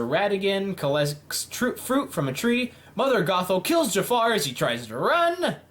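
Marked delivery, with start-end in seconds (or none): none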